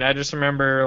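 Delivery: ordinary talk, nothing marked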